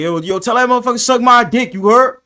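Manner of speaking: loud, high mock yell; background yell. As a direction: call it out from the back. Retelling a guy yelling background.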